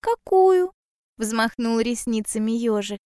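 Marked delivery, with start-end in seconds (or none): 0.0-0.7 high small-animal voice; curious little hedgehog. Acted. Hedgehog answering bear cub, high curious voice. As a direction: pipe up with a high question.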